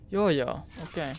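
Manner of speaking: ordinary talk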